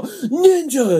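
mock battle cry. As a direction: shout it like goofy villains revealing themselves. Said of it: "they just all go".

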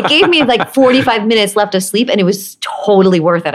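excited. Real, not acted. Delighted about extra sleep.

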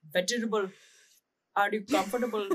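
Indian-accented dad impression; dad's Indian accent. Daughter showing how dad says vegetable, Indian accent. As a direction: copy dad's Indian pronunciation, fondly. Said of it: "the way he says vegetables".

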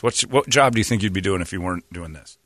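normal talking voice

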